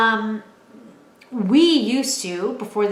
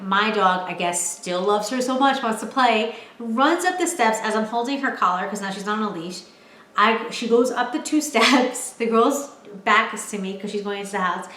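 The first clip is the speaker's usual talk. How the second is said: ordinary talk, nothing marked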